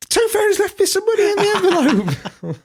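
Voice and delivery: put-on high, loud, thrilled kid voice; excited child. Boy bursting out of his room, announcing the money, excited. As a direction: squeal it like an overjoyed little boy.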